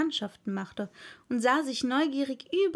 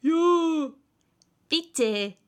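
chorus; 5 semitones higher, 6 dB louder than usual. A narrator's reading. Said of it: "wiederholten im Chor".

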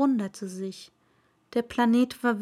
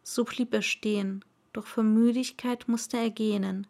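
plain reading voice

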